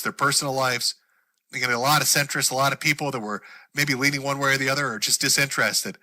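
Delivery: usual speaking voice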